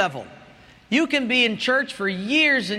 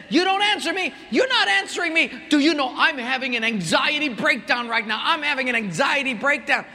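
hammed-up furious passenger; imitating angry yelling; 5 semitones higher than usual. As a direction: passenger shouting at the agent, mock angry. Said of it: "she kept yelling".

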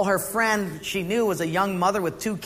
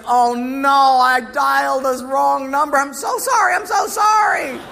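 much higher and louder, flustered apology; higher, louder caller mortified at a wrong number; embarrassed apology; 7 semitones higher, 6 dB louder than usual. Retold or imitated. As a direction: blurt out sorry, rising to a shout.

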